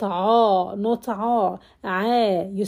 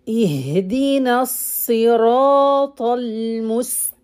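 chanted recitation; Quran recitation; 3 dB louder than usual. Acted. Teacher reciting the sixth ayah. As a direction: recite the verse melodically.

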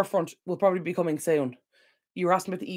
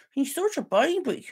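higher, slow, cooing dog talk; 8 semitones higher, slower than usual. Real, not acted. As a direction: coo it at the puppy, drawn out.

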